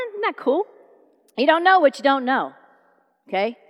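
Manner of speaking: ordinary delivery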